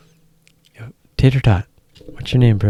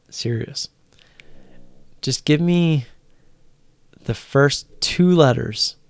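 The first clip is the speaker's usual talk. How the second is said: usual speaking voice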